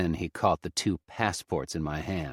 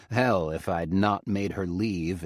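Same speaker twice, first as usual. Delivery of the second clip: ordinary delivery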